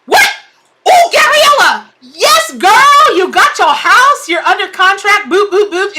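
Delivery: high, loud, thrilled squeal; overjoyed cheering for a friend who got her house; ecstatic shout. Real, not acted. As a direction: scream with joy for her friend's news.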